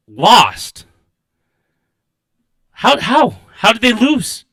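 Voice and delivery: high, loud disbelief; incredulous. Real, not acted. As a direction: ask it like you can't believe it. Outraged disbelief at result.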